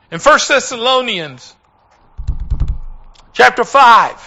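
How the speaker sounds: high, loud scripture proclamation; fervent sermon delivery; full-force preaching. Real, not acted. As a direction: drive the verse home, stretching key words.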